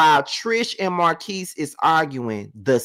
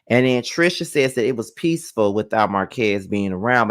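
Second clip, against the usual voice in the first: usual speaking voice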